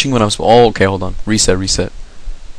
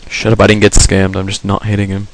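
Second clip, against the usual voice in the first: usual speaking voice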